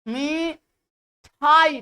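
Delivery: मिठाई split and stretched very slowly, ठाई set apart; stressed syllable. Shown as a demonstration. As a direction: drag out मिठाई with weight on ठाई. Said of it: "इस पे ज्यादा बल दिया जाता है".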